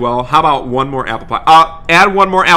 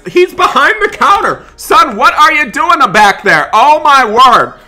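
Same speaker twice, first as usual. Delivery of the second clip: much higher, loud frantic yelling; panicked shout; 8 semitones higher, 6 dB louder than usual. Pretend. Dad shouting at son behind the McDonald's counter, loud. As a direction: yell for the runaway kid.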